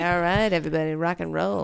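lightly voiced laid-back radio DJ; a hint of a smooth late-night DJ. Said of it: "I'm talking like a radio D J".

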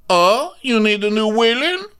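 high, put-on villain challenge; mock taunt. [name] offering herself as the villain, mock taunt. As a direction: toss it out in a hammed-up high voice.

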